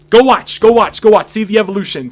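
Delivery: high, loud repeated push; loud urging. Real, not acted. Host urging fans to watch old episodes, loud and eager. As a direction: pump up the viewers to go watch.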